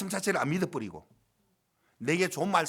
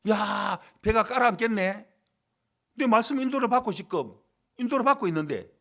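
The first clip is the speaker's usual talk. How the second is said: loud, higher-pitched acted protest; agitated complaint; 4 semitones higher, 6 dB louder than usual. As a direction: protest in mock panic as the boat fills. Voices disciple panicking in storm. (풍랑 속에서 다급하게 따지듯 큰 소리로)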